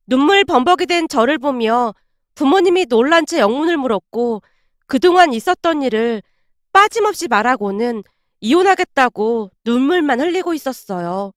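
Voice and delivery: ordinary delivery, nothing marked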